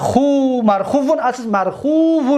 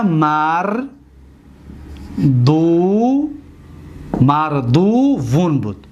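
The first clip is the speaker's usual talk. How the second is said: slow teaching; 3 semitones lower, much slower than usual. Shown as a demonstration. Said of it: "بس مسئله مهم است".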